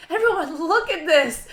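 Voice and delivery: loud, high teacher showing off work; acting out a proud shout; imitated calling out. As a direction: announce it to the whole class, excitedly.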